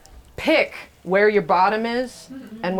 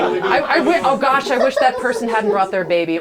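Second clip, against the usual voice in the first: mock annoyance; 5 semitones higher, 6 dB louder than usual. Mocking annoyed audience voice.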